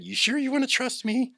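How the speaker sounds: can he really mean it; high, unsure disbelief; incredulous thought. Retold or imitated.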